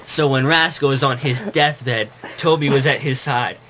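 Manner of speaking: ordinary talk, nothing marked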